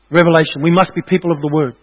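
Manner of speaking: usual speaking voice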